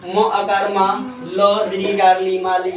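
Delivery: low, slow spelling-out of माली; हिज्जे करके उच्चारण. Shown as a demonstration. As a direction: spell म आकार मा, then long ली, joining into माली. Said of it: "इसका उच्चारण कैसे करेंगे". (धीमे सुर में माली का हिज्जे)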